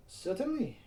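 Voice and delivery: proud. A narrator's reading. Elle proudly confirms the lights can self-adjust, proud. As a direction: answer with pride in your work. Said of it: "Elle answered proudly".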